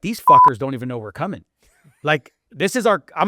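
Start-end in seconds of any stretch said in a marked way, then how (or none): 0.0-1.4 louder, swaggering boast; cocky loud quote. Retold or imitated. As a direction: throw it out like a defiant boast. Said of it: "we're like".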